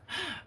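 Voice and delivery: high soft cry of dismay. Acted. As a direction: gasp it out high. Shocked cry in story.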